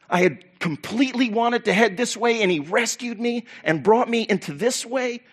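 high, wondering, excited questions; amazed reminiscing. Acted. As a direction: marvel aloud to fellow believers. Imagined believers marvelling together in heaven.

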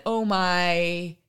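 drawn-out "my" left hanging; trailing off. Retold or imitated. Retelling what a boy reported hearing, trailing off. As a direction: imitate a kid letting the words trail away. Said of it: "and then he trailed off".

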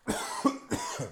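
real dry-throat cough. Real, not acted. Host with a lingering cold, real dry coughing. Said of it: "so I had to cough".

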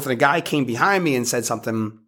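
ordinary talk

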